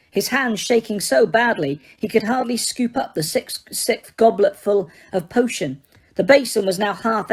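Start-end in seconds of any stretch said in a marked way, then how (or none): none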